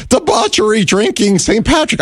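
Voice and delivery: high, rattled blabber; panicked babbling. Retold or imitated. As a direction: blurt random words in panic. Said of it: "started to blabber incoherently".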